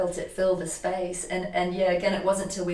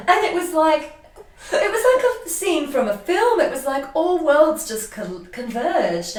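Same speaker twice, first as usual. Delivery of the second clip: higher, louder, laughing through the words; overwhelmed, laughing while telling it; laughing emotionally; 9 semitones higher, 7 dB louder than usual. Real, not acted.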